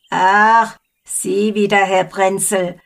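put-on a grumbling old woman, put out; sulky and annoyed at the neighbour; grumpy. A narrator's reading. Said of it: "antwortete missmutig".

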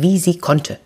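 ordinary delivery, nothing marked

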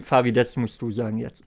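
ordinary talk, nothing marked

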